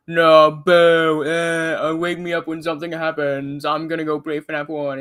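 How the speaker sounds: loud booing that settles into a mocking grumble; hammed-up dismissive complainer; mock bored heckling. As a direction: imitate a bored heckler, opening on a loud boo. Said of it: "He's like, "Oh, this game's boring".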